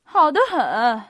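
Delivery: loud, emphatic exclamation; emphatic approval. A narrator's reading. Prince Hippolyte slapping his knee, loudly approving. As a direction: punch out the approval.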